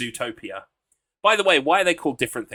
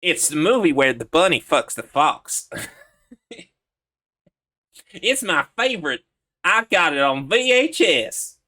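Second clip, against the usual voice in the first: hammed-up southern accent, lisped; lisping southern voice; 3 semitones higher, 5 dB louder than usual. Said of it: "you can add that lisp".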